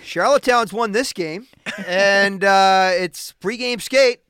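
pumped up, ready to go; excited. Real, not acted. Recalling pregame rink excitement.

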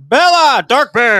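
high, loud call-out in mock slang; mock neighbor slang. Host imitating neighbors yelling slang, hammed-up high call. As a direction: holler it like a made-up neighbor. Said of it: "They'll start using slang like".

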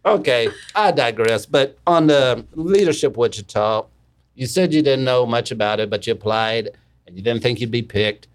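ordinary talk, nothing marked

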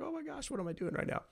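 stunned disbelief. Retold or imitated. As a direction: gasp it quietly to yourself in disbelief. Overwhelmed inner reaction.

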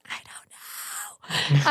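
imitated whimper, drawn out and voiceless; mock-crying, breath-only wail; retold tearful panic. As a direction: whisper it slowly like a helpless sob.

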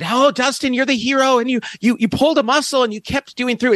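retold high-pitched gushing praise; gushing interviewer. Interviewer to the injured hero kicker, gushing. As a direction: imitate an excited interviewer, pitched well up.

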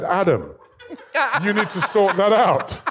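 laughing. Retold or imitated. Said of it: "and I said".

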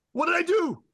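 very high, loud panicked squeal; comic falsetto wail of panic; mock panic. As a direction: act out a man's helpless alarm. Acts out a man's panic.

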